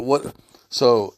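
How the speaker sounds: ordinary talk, nothing marked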